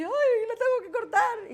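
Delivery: a surgeon losing his nerve, yelping in alarm; panicky surgeon. Pretend. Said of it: "imaginaos que vais al médico".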